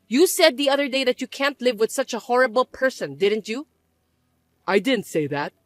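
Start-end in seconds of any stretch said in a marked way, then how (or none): none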